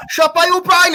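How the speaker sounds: sudden high, loud call of the shot; excited. Real, not acted. Commentator calling a shot on goal, excited. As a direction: voice jumps up as the shot flies in.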